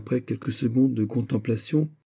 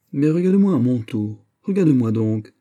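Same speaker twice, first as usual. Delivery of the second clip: louder, higher pleading cry; exclaiming; 5 semitones higher, 6 dB louder than usual. A narrator's reading. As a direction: demand his attention. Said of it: "s'écria le prince". (le prince s'écrie : regarde-moi)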